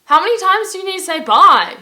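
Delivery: high, loud mock exasperation; amused disbelief. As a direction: exclaim it in baffled amusement, laugh breaking out. Acting her baffled reaction, laughing.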